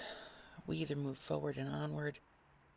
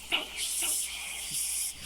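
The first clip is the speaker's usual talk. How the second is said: ordinary talk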